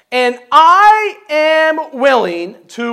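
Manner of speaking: drawn-out and loud; slow emphatic reading. Acted. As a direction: lean hard on every word. Preacher reading David's vow.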